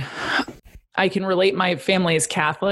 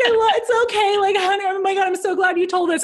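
high, laughing, tearful mom voice; gushing mom; 13 semitones higher than usual. Retold or imitated. Mom hearing her daughter came out, high and tearful. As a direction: gush with relief through laughter. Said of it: "She was like".

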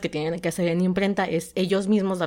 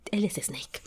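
hushed whisper; 2 semitones higher, 8 dB quieter, slower than usual. Acted. Fan's indignant thought, hushed.

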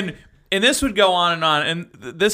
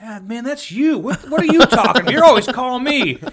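high, louder indignant pushback; mock indignant coworker; accusing coworker; 8 semitones higher, 5 dB louder than usual. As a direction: throw the blame right back. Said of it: "the other kid's like".

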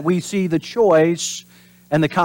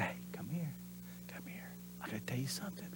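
very soft, hushed whisper; secret whisper; 5 semitones lower, 28 dB quieter, faster than usual. Retold or imitated. Parent whispering a secret to their kid. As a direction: whisper like you've got a secret. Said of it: "But say".

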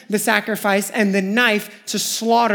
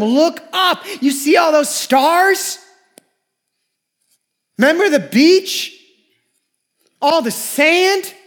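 God's promise called out higher and louder; God urging Abraham to look up, raised and slow; 7 semitones higher, 3 dB louder, slower than usual. Retold or imitated. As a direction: proclaim it like God under the stars.

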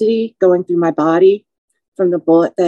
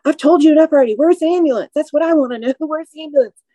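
high desperate plea; 8 semitones higher, faster than usual. Retold or imitated. Desperate inner plea, wounded.

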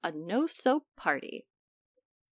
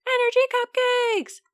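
high, squeaky echo of the phrase; squeaky germ voice; 13 semitones higher, 4 dB louder than usual. Acted. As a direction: pipe it up like a tiny germ.

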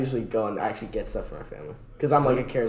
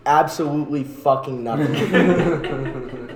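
laughing mock; 4 dB louder, slower than usual. Real, not acted. Mocking Alaska, laughing.

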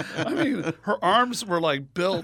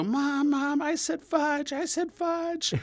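very high imitated kid's wail, building higher; panicked child confessing; 10 semitones higher, slower than usual. Boy confessing to mom before sister tells, high panicked cry.

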